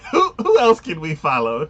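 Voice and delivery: high, loud laughing plea; wheezing with laughter. Real, not acted. Friend begging for another character, laughing.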